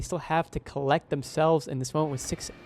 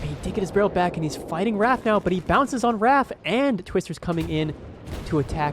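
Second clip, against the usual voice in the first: rising, higher excited play-by-play; esports caster; 4 semitones higher than usual. Real, not acted. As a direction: build the hype as the fight unfolds. Fight breaks out, caster rises.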